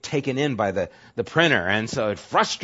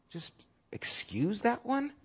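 whispered; 10 semitones higher, 10 dB quieter than usual. Real, not acted. Rhetorical question in sermon.

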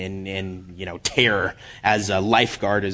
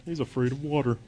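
mocking scoffer, softer aside; softly scornful mimicry; looking down the nose; 3 semitones higher, 7 dB quieter, faster than usual. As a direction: imitate someone sneering at a coward, quietly. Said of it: "looking down your noses at me".

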